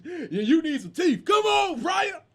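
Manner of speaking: high, loud mock shout; rowdy dice-game yell, acted out; crap game heckling. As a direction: holler at the player, building in pitch and volume. Acts out crap game scene.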